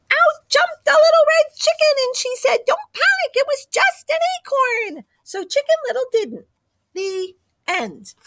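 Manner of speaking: high, breathless little chicken voice; excited high chicken voice. Acted. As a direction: burst in as an eager little chicken. Chicken bursts in a third time.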